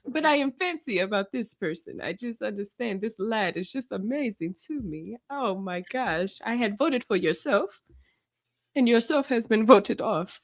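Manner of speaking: hammed-up, wandering British accent; badly faked British accent, theatrically dismayed; fake British accent. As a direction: play a gutted British reality contestant. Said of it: "Do, do your accent".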